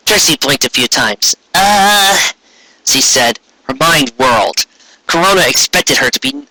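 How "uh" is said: higher startled pause; stall, caught off guard; Trixie's hesitant uh. Acted. Unicorn stalling before the queen's question, hesitant.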